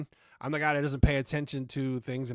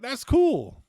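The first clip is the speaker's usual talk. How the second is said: high, loud fanboy squeal; a hammed-up burst of fan delight; mock excited; 12 semitones higher, 9 dB louder than usual. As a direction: blurt it out like a thrilled fan. Mock-excited reaction to scene.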